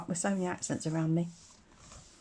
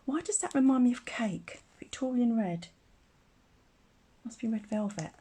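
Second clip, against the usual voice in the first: usual speaking voice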